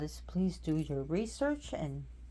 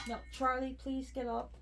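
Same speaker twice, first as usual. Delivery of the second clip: soothing pet; 6 semitones higher, 9 dB quieter than usual. Real, not acted.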